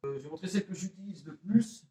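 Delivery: ordinary talk, nothing marked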